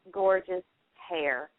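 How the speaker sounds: ordinary talk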